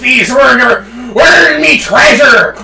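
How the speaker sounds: hammed-up sea-dog shout; pirate voice. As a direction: growl it out like a storybook pirate. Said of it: "It's a pirate voice".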